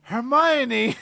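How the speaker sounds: loud, high blurted name; mock Harry blurting. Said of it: "first thing out of his mouth is".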